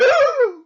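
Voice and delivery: sharp, high-pitched gasp; loud excited gasp. Retold or imitated. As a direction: re-enact a huge starstruck gasp. Acting out reaction to seeing Chewie.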